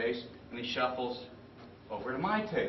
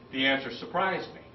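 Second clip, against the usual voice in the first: usual speaking voice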